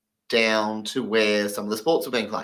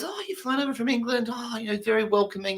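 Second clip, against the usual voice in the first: higher, softer, friendly voice; welcoming deaf man; 9 semitones higher, 6 dB quieter than usual. Retold or imitated. Dutch club member welcoming the English visitor, higher. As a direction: greet him sweetly, a little high. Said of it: "this guy goes".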